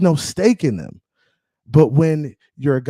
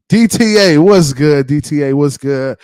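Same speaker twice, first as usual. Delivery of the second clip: calling out; 7 dB louder than usual. Real, not acted. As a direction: holler a greeting to a viewer. Calling out greeting to a viewer.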